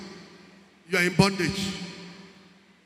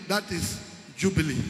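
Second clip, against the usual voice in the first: ordinary talk, nothing marked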